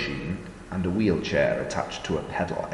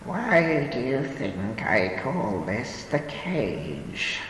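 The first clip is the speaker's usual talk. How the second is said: put-on slow, grave old woman voice; 6 semitones higher, much slower than usual. A narrator's reading. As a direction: answer gravely and slowly. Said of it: "she answered gravely".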